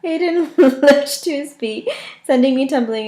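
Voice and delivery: high, slow words through laughter; laughing while reading. Real, not acted. Reader still laughing as she reads the line. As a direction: read it while cracking up.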